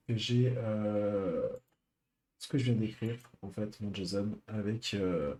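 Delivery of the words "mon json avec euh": usual speaking voice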